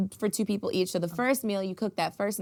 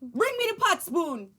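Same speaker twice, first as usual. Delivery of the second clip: scolding parent; 6 semitones higher than usual. Retold or imitated. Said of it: "impression of your mom or auntie".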